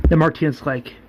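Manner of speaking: ordinary delivery, nothing marked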